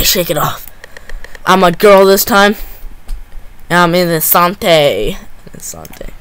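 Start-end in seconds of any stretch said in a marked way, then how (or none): 5.1-6.1 whisper. Real, not acted. Repeats words while hiding.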